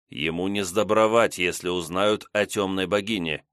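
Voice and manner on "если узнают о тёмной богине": usual speaking voice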